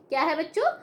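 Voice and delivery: ordinary talk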